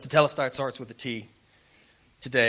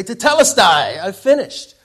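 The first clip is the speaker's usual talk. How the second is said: higher, much louder triumphant call; excited boy; 7 semitones higher, 16 dB louder than usual. Acted. Boy runs to show mom his paper, excited shout. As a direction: burst out like a boy done with homework.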